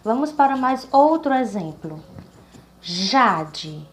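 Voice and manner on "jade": "Jade" drawn out on "Ja", falling away after; stress on first syllable. Shown as a demonstration. Teacher showing the strong syllable, stressed "Ja" in "Jade". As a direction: stretch and punch the "Ja" in "Jade". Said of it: "ela é a mais forte".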